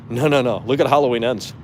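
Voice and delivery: ordinary delivery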